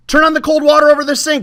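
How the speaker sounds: very high, loud, fast command; urgent order. Acted. Old woman ordering the boy to the sink, urgent. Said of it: "she ordered".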